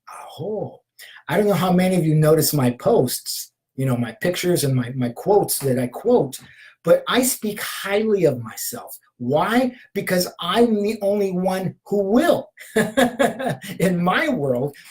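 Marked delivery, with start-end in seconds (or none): 0.1-0.8 whisper. Real, not acted. Affirmation ending in ritual aho. 12.5-13.7 laugh. Real, not acted. Laughs at own line.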